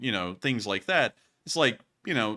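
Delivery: usual speaking voice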